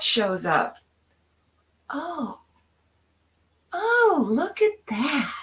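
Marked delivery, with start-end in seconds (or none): 1.9-5.3 high, tender marvelling; gentle wonder. Shown as a demonstration. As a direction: greet whatever shows up with wonder. Modeling trusting response.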